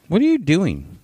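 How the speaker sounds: high-pitched scold; scolding the dog. Real, not acted. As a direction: call out sharply to a misbehaving dog in a high voice. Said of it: "Now you hear me yelling at my dog".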